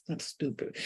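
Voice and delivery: whispered dismissive remark; whispered spirit guides. Retold or imitated. Spirit guides judging her, whispered and scornful. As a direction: breathe it out as a scornful aside. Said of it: "And they're like".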